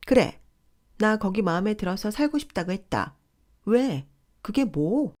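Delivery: a high, loud shriek of a yell; sister-in-law shrieking down the phone; screeching at her. Acted. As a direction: scream it back defiantly. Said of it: "라며 악을 쓰길래".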